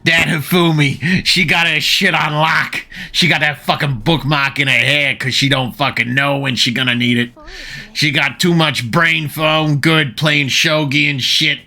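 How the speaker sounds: a brash Boston guy bragging about her brains; Boston accent. Retold or imitated. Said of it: "Boston accent".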